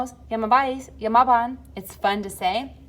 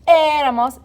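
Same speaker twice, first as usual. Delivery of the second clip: Éramos with a loud, high, drawn-out É; stress on the É; 7 semitones higher, 9 dB louder than usual. Shown as a demonstration. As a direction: punch and stretch the first syllable of Éramos. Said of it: "Accent on that E in éramos".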